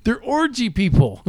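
high slow dawning oh; amazed realization, drawn out; sudden realization. Retold or imitated. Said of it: "I'm like".

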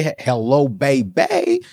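mock smooth greeting to a sweetheart; playful flirting. Husband greeting the wife he wants, playful flirting. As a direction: draw out a playful come-on.

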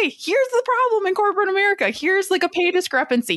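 performing a corporate callout post; mock LinkedIn post voice. Reading her LinkedIn post aloud, mock proclamation. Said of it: "of I say".

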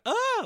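high, loud mock scream; girlish shriek. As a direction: squeal like a frightened little girl. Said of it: "like a little girl".

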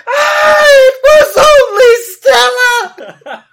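very high, very loud shrieked words, slowed; scream turning into reassurance. Retold or imitated. Man of the house reassuring his family mid-scream, shrieked words. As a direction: scream that bends into a reassuring shout. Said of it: "I changed my scream into reassuring words".

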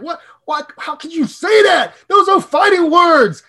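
high, loud, indignant, slowing on fighting words; mock outrage. Host playing an offended Cowboys fan, hammed-up outrage. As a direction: protest like your team was insulted.

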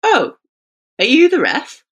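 imitating a player caught off guard, pitch up; slightly surprised. Player meeting the female ref, mildly surprised. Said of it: "Slightly surprised".

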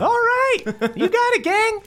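very high, chipper scoutmaster; Scoutmaster Denny. Acted. Scoutmaster greeting the gang, very high and chipper. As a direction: greet them in a squeaky, eager voice. Denny happily takes the order.

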